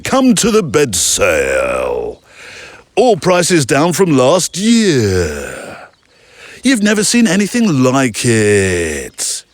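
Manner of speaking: very slow advert voice, dropping pitch and hanging on last syllables; mock a cheesy bed-sale voiceover; drawn-out voiceover, last syllables held long; ad voiceover parody. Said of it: "down inflection and hang on to the last syllable syndrome".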